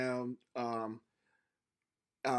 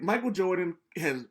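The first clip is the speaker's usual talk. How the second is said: ordinary talk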